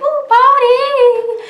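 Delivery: high, slow squealed "Ooh, party!"; a very high drawn-out goofy celebration. Retold or imitated. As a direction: squeal with silly glee over a new subscriber. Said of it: "I told you I get weird".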